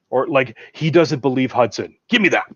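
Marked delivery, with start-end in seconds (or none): 2.1-2.4 impatient demand, higher and louder; raised, commanding movie quote. Acted. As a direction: demand it briskly, as a doubting soldier. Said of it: "but then Hudson or Hicks".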